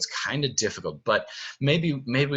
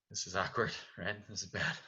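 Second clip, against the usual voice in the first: lower, much softer Beast mumble; awkward Beast mutter; 3 semitones lower, 12 dB quieter, faster than usual. Shown as a demonstration. Beast mutters to himself at dinner with Belle, much softer. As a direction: grumble it under your breath as the Beast. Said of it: "and Beast".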